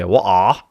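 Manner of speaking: "What are" with the t dropped, open loud ending; regional British "water". Retold or imitated. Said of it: "somebody told me that, um, where they come from".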